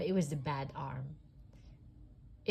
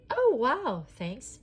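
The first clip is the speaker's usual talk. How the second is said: loud, high delighted call; Andy thanking his mother from upstairs; calling downstairs; 8 semitones higher, 8 dB louder, slower than usual. A narrator's reading. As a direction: call it down the stairs to mom. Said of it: "he called downstairs".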